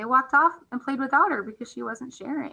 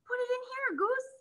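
mild shout; 6 dB quieter than usual. A narrator's reading. Hare holding out the jar to Goose, mild high call. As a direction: call out eagerly, lightly done. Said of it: "shouted Hare".

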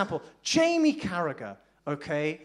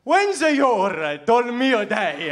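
fake Italian; 7 dB louder, slower than usual. Continuing fake Italian bit.

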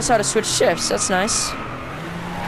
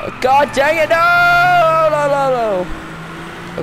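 drawn-out wail of no, higher pitched; dismayed cry; 5 semitones higher, much slower than usual. Real, not acted. Player wailing as the car goes wrong, dismayed cry. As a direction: cry out, then let the no's tumble downward. Crashing while playing racing game.

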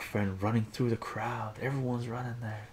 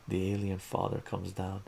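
plain reading voice

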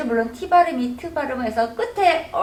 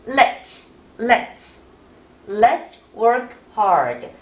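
slow model read; much slower than usual. Shown as a demonstration. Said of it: "다 같이".

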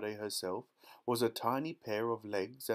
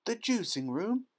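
high, rising shocked question; horrified mother's high echo; alarmed cry; 11 semitones higher, 4 dB louder than usual. A narrator's reading. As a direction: cry out aghast. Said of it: "cried Missus Beauregard".

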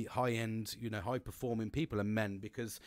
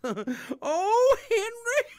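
very high, loud squealed call; hammed-up shriek breaking into laughter; put-on high-pitched cry for a mate; comic wail; 10 dB louder, much slower than usual. Clowning impression for his mate.

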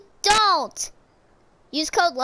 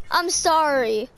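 usual speaking voice

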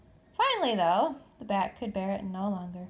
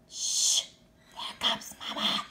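urgent whispered hiss; whispered shushing; hissed warning. A narrator's reading. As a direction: hush the others before Mama sees. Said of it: "hissed Pip".

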